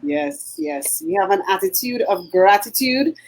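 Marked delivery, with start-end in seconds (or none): none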